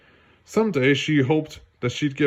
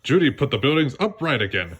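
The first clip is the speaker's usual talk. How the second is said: ordinary delivery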